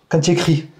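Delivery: ordinary talk, nothing marked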